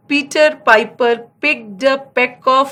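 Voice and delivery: very slow, each word laid out; slow tongue twister. Shown as a demonstration. Teacher demonstrating a tongue twister, very slow. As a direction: spell the tongue twister out for the class. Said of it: "ये टंग twister है".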